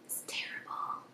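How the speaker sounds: sheepish whispered aside; whispering. Real, not acted. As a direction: whisper it like a confession. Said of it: "unvoiced whisper".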